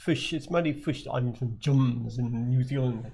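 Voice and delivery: mock New Zealand accent with squashed short vowels. Guest riffs on the fish joke, mock New Zealand accent. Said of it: "we've got a New Zealand accent".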